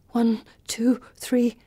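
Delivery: hushed counting whisper. A narrator's reading. Counting to ten in terror.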